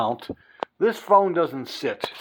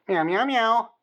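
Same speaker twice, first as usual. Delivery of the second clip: higher, playful meows; imitating cat meows; 9 semitones higher than usual. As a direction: meow back at the cat like she does. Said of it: "She talks".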